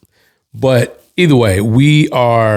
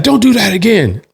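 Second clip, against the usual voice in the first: imitating a miffed opponent, much higher pitch; mock protest, pitched way up; exasperated demand; 10 semitones higher than usual. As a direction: voice his complaint high and put out.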